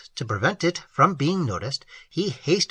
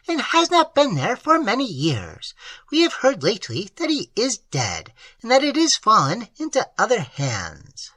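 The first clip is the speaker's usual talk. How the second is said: put-on higher, earnest voice for the old host; higher voice of a plain-spoken country host; old peasant host; 7 semitones higher than usual. A narrator's reading. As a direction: voice the simple host, eager to tell. Host talks of the Marquis.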